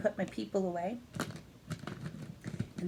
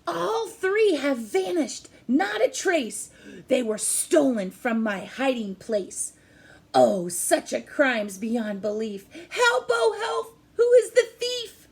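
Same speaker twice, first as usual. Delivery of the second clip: loud, higher wailing squirrel crying for help; distraught squirrel; 4 semitones higher, 7 dB louder than usual. Acted. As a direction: wail it like a desperate squirrel. Squirrel's lament continues.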